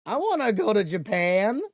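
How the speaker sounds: loud, high mock whining; mock jealous whine. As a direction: wail it like a jealous kid, playfully. Jealous whine, repeated louder.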